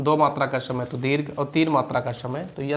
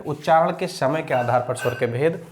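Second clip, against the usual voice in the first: ordinary talk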